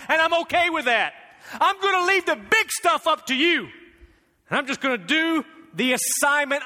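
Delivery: high, loud, driving sermon voice; preaching at full force. Real, not acted. Preacher voicing Jesus's surrender, at full force. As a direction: preach it out, building conviction.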